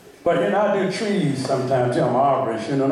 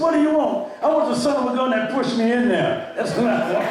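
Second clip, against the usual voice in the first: excited retold punchline; 8 semitones higher than usual. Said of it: "He said".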